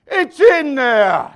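slow, drawn-out high bellow; Italian papa bellowing. Retold or imitated. Papa answering about spices, hammed-up slow bellow. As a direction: roar it back, stretching the words. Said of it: "He says".

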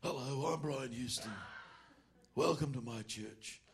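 mock walrus-like preacher voice; slow, hammed-up send-up of a preacher's welcome; Brian Houston. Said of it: "He's taking off Brian Houston. He sounded like a walrus".

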